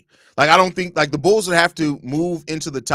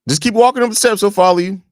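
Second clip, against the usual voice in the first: calling out; 6 semitones higher, 7 dB louder than usual. Real, not acted. Calling to son up stairs.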